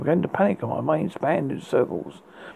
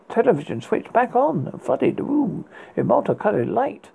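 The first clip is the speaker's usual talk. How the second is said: ordinary delivery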